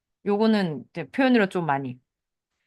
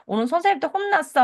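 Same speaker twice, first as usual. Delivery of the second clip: put-on high, sulky kid telling mum about school; a child complaining she got scolded; childlike whine; 9 semitones higher, faster than usual. 아이 말투로 예문 연기. (선생님한테 혼난 아이가 엄마에게 투덜대듯 높게)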